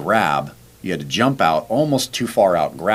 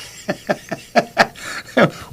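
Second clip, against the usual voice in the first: high laugh. Real, not acted. Laughing at Andy's answer.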